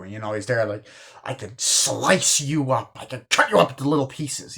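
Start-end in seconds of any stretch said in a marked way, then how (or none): 1.2-4.6 hammed-up threat, leaning on the verbs; mock threat. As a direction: imitate a menacing scolding. Acting out character's threat to students.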